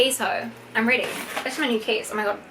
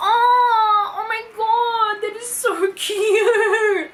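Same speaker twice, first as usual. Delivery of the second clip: high, louder, slow, laughing gush; gushing squeal; 10 semitones higher, 3 dB louder, much slower than usual. Real, not acted. Finding more keychains from her friend, squeals and laughs. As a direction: melt over something adorable.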